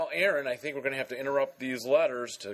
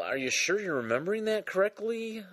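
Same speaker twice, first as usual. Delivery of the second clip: ordinary talk, nothing marked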